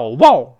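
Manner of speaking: "wow" as a short, loud "wou"; dog bark. Shown as a demonstration. Teacher contrasting dog with cat, barking. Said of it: "不是狗叫".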